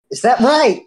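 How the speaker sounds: building to a loud, high, hammed-up demand; mock insistent. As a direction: push harder each time you ask. Mock insistent repeated question.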